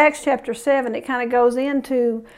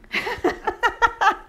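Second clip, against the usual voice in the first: short laugh; 9 semitones higher, 5 dB quieter than usual. Real, not acted. Laughs at his own pun.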